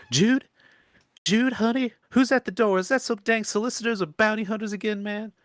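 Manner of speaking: high, loud holler from inside the shack; old swamp woman calling out. Acted.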